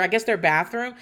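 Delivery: ordinary talk, nothing marked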